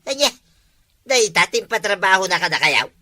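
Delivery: ordinary delivery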